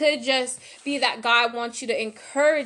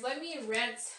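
normal talking voice

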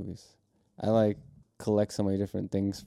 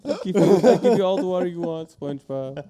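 laughing; 8 semitones higher, 4 dB louder than usual. Pretend. Mock earnest offer, laughing.

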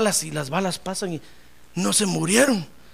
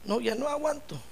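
soft, higher plea of exhaustion; worn-out lament; 6 semitones higher, 8 dB quieter than usual. Acted. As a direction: act out giving up, quietly. Ana agotada se desahoga. (queja rendida en voz baja)

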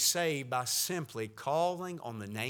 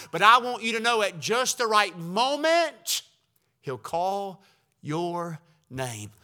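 fervent preaching; 4 semitones higher, 6 dB louder than usual. Acted. Preaching at full force.

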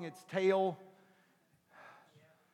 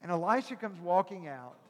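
ordinary talk, nothing marked

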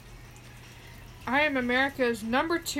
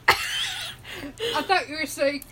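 laughing; 5 semitones higher, slower than usual. Real, not acted. Laughing at the misunderstanding.

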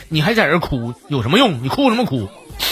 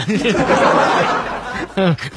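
laughing; 2 semitones higher than usual. Real, not acted. Laughs after punchline.

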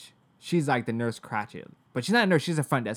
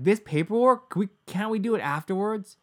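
much higher, louder, strained plea; upset pleading; 8 semitones higher, 4 dB louder than usual. Retold or imitated. Patient pleading with the receptionist to do the paperwork later, in an upset, higher plea. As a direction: beg to put the paperwork off.

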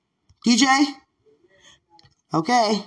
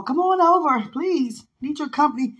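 pleading; 8 semitones higher than usual. Retold or imitated. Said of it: "I got a phone call".

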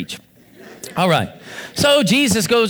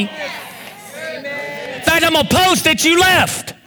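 defiant pulpit declaration, raised pitch; fervent preacher, high and forceful; full-force preaching; 7 semitones higher than usual. Acted. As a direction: refuse to back down, driving each phrase.

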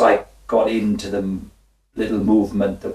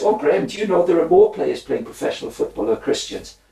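higher, lightly hammed-up posh man; posh gentleman; 9 semitones higher than usual. Retelling the posh visitor's boast, mock-posh voice. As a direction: play the cheerful posh visitor, pitched up. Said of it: "he says".

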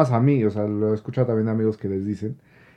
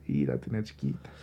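soft, low gossip to friends; lightly voiced gossip, lower and softer; gossiping aside; 10 semitones lower, 13 dB quieter than usual. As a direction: drop it low like telling a secret. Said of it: "ya va con las amigas y dice".

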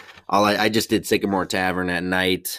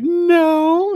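high, loud hammed-up wail; mock crying; 6 dB louder than usual. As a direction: wail it out like an exaggerated sobbing customer. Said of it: "hear the one start to cry".

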